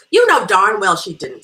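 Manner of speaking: loud, emphatic contradiction; accusing retort. Acted. Guest challenging hostess's family story, accusing retort. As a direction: come in hard to call out a friend.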